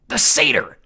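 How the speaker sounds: loud unvoiced yell. Clarice shouting the secret to the Cyclops, loud yell. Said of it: "Clarice yelled".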